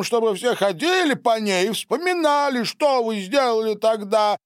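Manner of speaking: high-pitched, sarcastic tirade; angry, scornful rant. A narrator's reading. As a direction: rail with mounting contempt. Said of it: "зло усмехнулся Дональд". (злая язвительная тирада высоким голосом)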